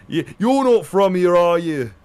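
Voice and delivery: mock Brummy accent. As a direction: imitate a suspicious Birmingham local. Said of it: "I get".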